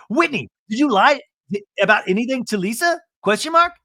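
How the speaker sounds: high, loud, over-careful questioning; mock interrogation. John questioning Whitney about lying, in a high, insistent voice. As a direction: raise the pitch right up to the end.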